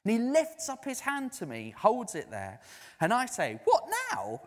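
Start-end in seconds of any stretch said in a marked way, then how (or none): 3.7-4.4 a much higher startled what, now; the preacher, surprised, asks the man if he means right now. Retold or imitated. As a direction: caught off guard by the stranger's yes. Surprised reply in retold story.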